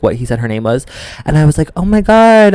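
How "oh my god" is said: high-pitched retold gush. Guest reacting to meeting his cat, gushing. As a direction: coo it high, as if smitten.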